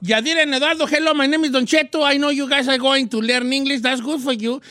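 Spanish-accented English, hammed up; joking English greeting with a Mexican accent; mock English with a Mexican accent. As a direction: show off his English with a thick Mexican accent. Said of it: "Very important to talk with no accent".

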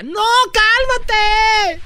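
high, slow, drawn-out woman's plea; drunk woman's voice. Retold or imitated. Host imitating the drunk girlfriend pushing him off, high and slow. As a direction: stretch the cálmate up high like a tipsy girlfriend. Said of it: "y ella".

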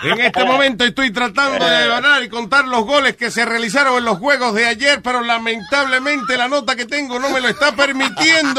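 stoned newscaster. Pretend.

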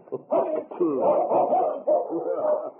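long, high amused laugh; delighted, drawn-out laughter; hearty laugh. Acted. Scotty laughs watching the boy.

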